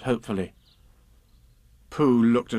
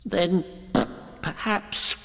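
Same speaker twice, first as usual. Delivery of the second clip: anxiously; 8 semitones higher, much slower than usual. Acted. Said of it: "suggested Piglet anxiously".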